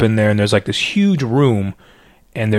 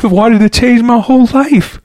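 yearning; 12 semitones higher, 4 dB louder than usual. Real, not acted. Recalling his longing to change.

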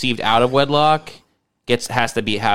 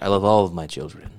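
lower, softer sinister patriarch; movie villain; 5 semitones lower, 4 dB quieter than usual. Retold or imitated. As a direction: purr it like a scheming crime boss. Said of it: "the villain in the movie ... you're like".

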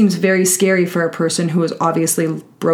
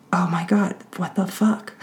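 under-breath shock; 6 dB quieter than usual. Retold or imitated.

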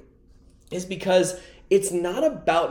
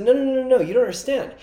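higher, louder run of "no"; a coach brushing off a small goal, raised and insistent; emphatic coach denial; 7 semitones higher, 3 dB louder, much faster than usual. Retold or imitated. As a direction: cut him off like an insistent coach. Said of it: "He said".

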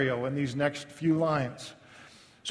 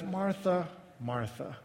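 softer, fading on the second Martha; soft and gentle; 9 dB quieter, slower than usual. Retold or imitated. Jesus gently calms frustrated Martha: soft, tender name. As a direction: call her name tenderly, dropping away. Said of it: "he says it kind of softly".

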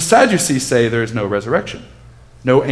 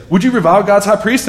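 YOU lifted higher and a bit louder, rising challenge; pointed rhetorical question; 8 semitones higher, 4 dB louder than usual. Shown as a demonstration. Teacher rereads the priest's men, indignant. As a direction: throw the question back with indignation. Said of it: "I don't know if this is sarcastic".

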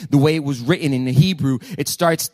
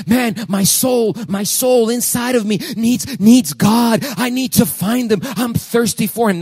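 higher, slow pleading voice; desperate longing; 6 semitones higher, slower than usual. Retold or imitated. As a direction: beg slowly, voice lifted with longing. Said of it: "David is saying".

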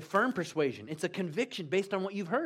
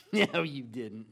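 dismissive doubt; 7 semitones lower, slower than usual. Acted. Thomas acted out doubting the disciples.

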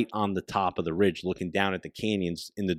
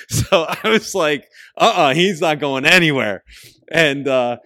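laughing, louder and higher; 7 semitones higher, 9 dB louder than usual. Real, not acted. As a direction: talk through the laughter. Laughing retelling the kill.

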